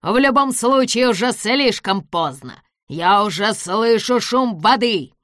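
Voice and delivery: loud, excited gloating. A narrator's reading. Villain hears the water coming, gloating. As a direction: crow it loudly, drawing out the end.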